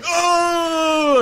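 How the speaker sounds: sudden high cry of dismay; frustrated cry. Retold or imitated. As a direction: cry out as if you just missed out. Player regrets a missed choice.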